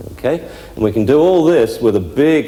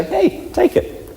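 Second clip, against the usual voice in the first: much higher, slow and sing-song; cheery release cue; slower than usual. Shown as a demonstration. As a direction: lift it high and slow for the dog. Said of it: "we can say".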